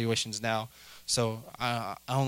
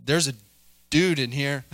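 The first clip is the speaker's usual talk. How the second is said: alarmed call; 4 dB louder than usual. Pretend. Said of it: "how do you not leave and go be like".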